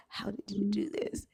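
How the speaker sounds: soft, unvoiced whisper. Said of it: "I whispered in his ear".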